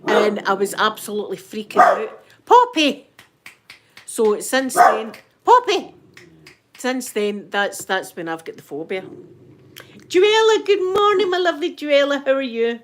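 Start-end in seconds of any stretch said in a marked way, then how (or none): 2.4-3.1 high, loud call across the room; calling the dog. Real, not acted. 5.4-5.9 high, loud call across the room; calling the dog. Real, not acted. 10.1-12.8 excited greeting. Real, not acted. Greeting a viewer warmly.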